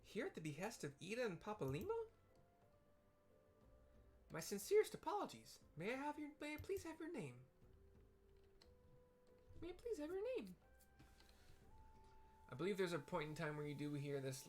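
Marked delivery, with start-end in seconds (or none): 0.1-10.6 very high, polite and eager; tiny helpful clerk, very high; sweet high Lalafell. Acted. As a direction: squeak out an apology and ask sweetly. Voicing Tataru apologizing.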